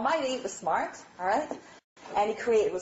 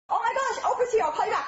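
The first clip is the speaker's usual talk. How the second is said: much higher, thrilled and hurried; star-struck wife rushing off the phone; high giddy excitement; excited gasp; 12 semitones higher than usual. Pretend. Wife sees Oprah arrive.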